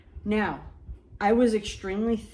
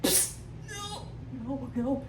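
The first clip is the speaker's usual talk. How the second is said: whimpering no; 6 semitones higher, 6 dB quieter, slower than usual. Retold or imitated. Crouching, holding in pee.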